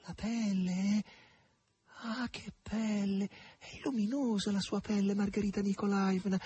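a maid gazing in awe at her mistress; near veneration. A narrator's reading. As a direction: marvel at her skin almost reverently. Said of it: "quasi con venerazione".

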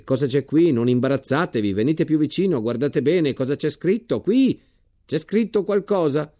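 loud, high, coaxing officer's voice; booming general. Acted. General urges the embarrassed soldier to read the bayonet, loud and high. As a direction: call the soldier closer at full volume.

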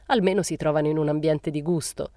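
plain reading voice

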